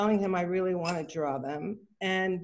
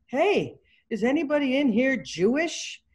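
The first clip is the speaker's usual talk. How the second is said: calling out; 6 semitones higher, 3 dB louder than usual. Retold or imitated. Yehuda calls into the class.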